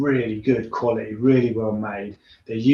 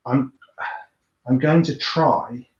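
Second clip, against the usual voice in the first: normal talking voice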